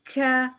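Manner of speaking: ख held then let out as a breathy puff; ख with an audible release of air; whispered release. Shown as a demonstration. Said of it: "ऐसे".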